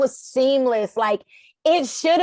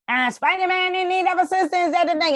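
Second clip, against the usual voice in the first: mock walkie-talkie report; 7 semitones higher than usual. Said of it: "The walkies like".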